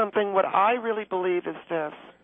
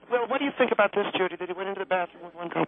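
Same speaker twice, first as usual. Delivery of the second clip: usual speaking voice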